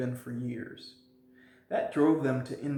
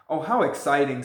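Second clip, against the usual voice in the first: a burst of louder delight; excited; 8 dB louder than usual. A narrator's reading. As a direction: gasp it out, thrilled. Polly thrilled by the mystery.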